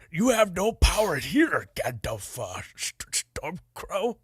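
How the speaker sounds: a light Minnesotan Jesse Ventura take on Theoden; hammed-up Jesse Ventura voice. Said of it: "give me your best Jesse Ventura as King Theoden".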